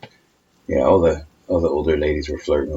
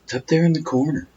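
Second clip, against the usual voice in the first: higher, dreamy sleep-talk voice; sleep talker; 10 semitones higher than usual. Retold or imitated. Imitating wife talking in her sleep, higher and dreamy. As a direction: mumble it like someone asleep. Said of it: "you started talking about".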